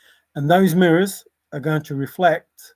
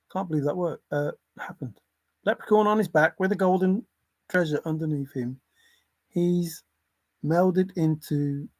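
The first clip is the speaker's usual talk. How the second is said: normal talking voice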